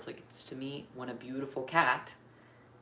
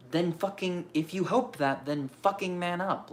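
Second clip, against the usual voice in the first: angry rant; 4 semitones higher, 6 dB louder than usual. Real, not acted. Venting anger at men.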